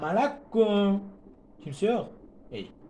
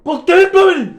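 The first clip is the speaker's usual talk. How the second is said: much higher and louder, fed up; exasperated yell; 11 semitones higher, 11 dB louder than usual. Real, not acted. Gamer yelling at his game, exasperated.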